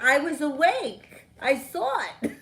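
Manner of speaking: indignant protest. Retold or imitated. Arguing with father she really saw it, indignant protest. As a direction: insist hard, voice up. Retold argument with her father.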